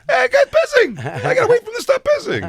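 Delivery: shrieked way up high and loud; loud high squeal. Retold or imitated. Telling Christine about the pisser, loud high squeal. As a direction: squeal it in a panicked falsetto. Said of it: "I say it so loud, like, so high pitched".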